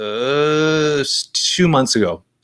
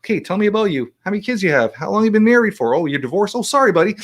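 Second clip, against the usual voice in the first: put-on higher, eager kid-like questions; curious little kid; 9 semitones higher than usual. Host imitating himself quizzing guests, lightly kid-like. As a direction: ask like a nosy little kid. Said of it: "like, a curious little kid".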